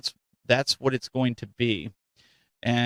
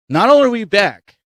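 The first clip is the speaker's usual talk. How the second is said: excited; 8 semitones higher, 10 dB louder than usual. Real, not acted. Hyped host announcing return.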